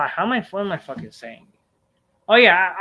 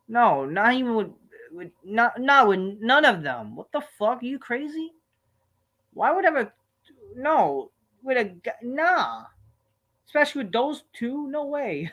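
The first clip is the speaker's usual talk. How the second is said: rising in disbelief, much higher; are-you-crazy disbelief, higher and higher; incredulous protest; 9 semitones higher than usual. Real, not acted. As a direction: recoil at the idea, voice climbing. Asked an outrageous question.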